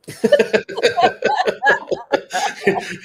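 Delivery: long high airy laugh; high, loud wheezing laughter; breathy laugh. Real, not acted. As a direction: crack up at the punchline. Laughing at the story.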